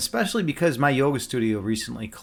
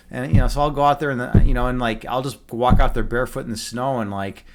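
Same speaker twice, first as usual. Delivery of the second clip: usual speaking voice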